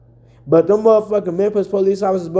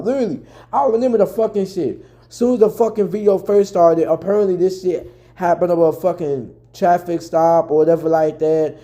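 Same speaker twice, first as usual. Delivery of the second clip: ordinary delivery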